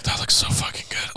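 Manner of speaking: unvoiced whisper of late-night hunger; whispered craving. Watching food videos in bed at 3 a.m., whispering. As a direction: breathe it out like a secret temptation. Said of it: "and I'm like".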